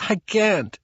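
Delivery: a mild whimpered refusal. A narrator's reading. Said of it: "she whimpered".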